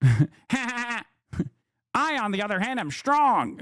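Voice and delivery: lightly put-on gloating nerd with fake laughs; mock a smug nerd bragging about strength; mocking nerd loser. Streamer mimicking a boastful nerd, mocking. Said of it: "you're over here being like".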